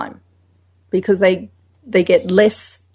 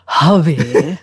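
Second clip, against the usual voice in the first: lower, put-on silly darling voice; mildly silly low voice; 4 semitones lower than usual. Shown as a demonstration. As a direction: ham it up to a partner. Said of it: "if you adopt a silly voice and say".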